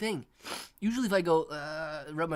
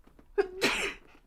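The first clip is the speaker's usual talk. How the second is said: sharp real cough; a sudden hard cough; 6 dB louder than usual. Real, not acted.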